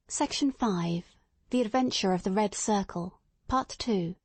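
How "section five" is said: ordinary delivery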